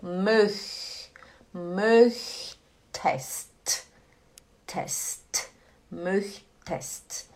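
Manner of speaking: "möch", long hiss, then a separate crisp "test"; airy drawn "möch", short quiet "test"; step-by-step pronunciation drill. Shown as a demonstration. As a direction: build "möchtest" slowly in two parts, softly. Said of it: "Você fala möch e puxa aqui".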